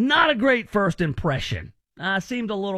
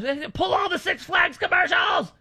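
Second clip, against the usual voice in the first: imitating a frantic rep; 12 semitones higher than usual. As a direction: shout the order like a panicked rep. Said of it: "she would run through those".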